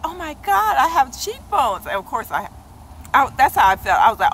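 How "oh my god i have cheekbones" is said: excited. Retold or imitated. Retold thrilled reaction.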